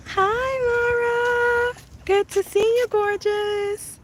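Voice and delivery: high, sing-song greeting; affectionate gushing. Real, not acted. Streamer greeting Mara as gorgeous, affectionate coo.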